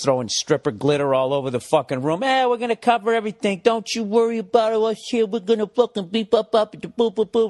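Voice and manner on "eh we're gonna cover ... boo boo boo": higher, chummy adjuster promising everything; cheery salesman impression. Comic mocking the smiling insurance adjuster, in a higher breezy voice. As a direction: play the grinning insurance guy, breezy and reassuring. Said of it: "he's all fucking smiles and rosy cheeks".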